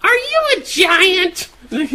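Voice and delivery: put-on very high, slow child voice; little kid voice. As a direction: squeak it like a tiny kid. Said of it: "a little kid dressed as a clown walks up and goes".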